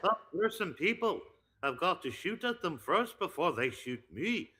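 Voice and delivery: put-on high, loud, lilting botched Scottish accent; weird Scottish leprechaun. Retelling his Fortnite play, loud botched Scottish accent. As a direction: swoop up and down in a leprechaun's Scottish brogue. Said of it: "sounding like some weird leprechaun".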